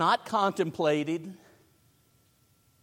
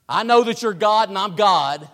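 usual speaking voice

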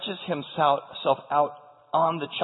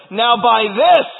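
loud, higher, emphatic cry; 5 semitones higher, 9 dB louder, much slower than usual. Acted. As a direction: hit THIS high and loud. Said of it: "she cries out to Elijah".

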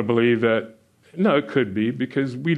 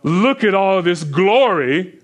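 boastful king; 5 semitones higher, 4 dB louder, slower than usual. Retold or imitated. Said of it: "and he says, what?".

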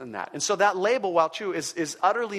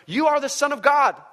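loud, much higher shout; 11 semitones higher, 9 dB louder than usual. Acted. Demons shout at Jesus, loud high cry. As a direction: cry it out like possessed voices. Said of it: "demons came out of many people shouting".

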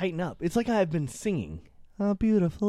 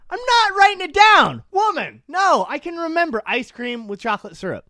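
stubborn husband; 9 semitones higher, 7 dB louder than usual. Retold or imitated. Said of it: "he goes".

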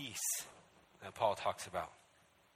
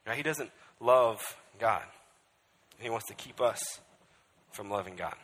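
usual speaking voice

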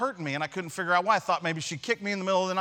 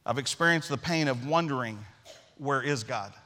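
earnest preaching; 5 semitones lower, 3 dB quieter than usual. Acted. Reflective sermon litany on pain.

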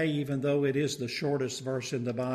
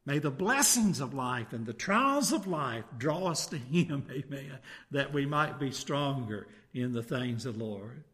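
ordinary talk, nothing marked

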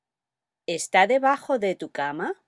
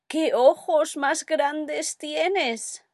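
put-on high, slow, wide-eyed little girl voice; drawn-out, awed girl marvelling at big eyes; 9 semitones higher, much slower than usual. Caperucita gasping at the wolf, high and slow. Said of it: "Ella dice".

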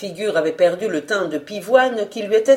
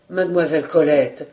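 usual speaking voice

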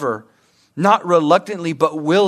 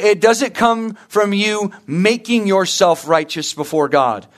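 ordinary talk

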